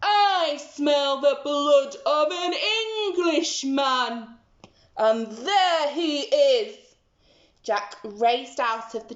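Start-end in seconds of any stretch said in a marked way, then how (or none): 0.0-6.8 slow, menacing giant with a laugh; gloating giant. Acted. The giant spots Jack, gloating slowly. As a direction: gloat slowly as you spot him.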